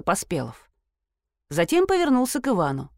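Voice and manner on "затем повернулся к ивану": plain reading voice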